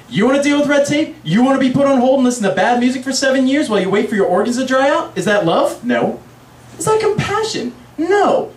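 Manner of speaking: high, insistent rhetorical questioning; heated self-justification; indignant sales pitch. Acted. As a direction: rattle off the grievances, then answer yourself emphatically. Organ dealer defends trade, indignant.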